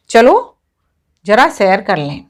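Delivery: a clear break after an emphatic चलो; comma pause. Shown as a demonstration. Example sentence showing a comma pause.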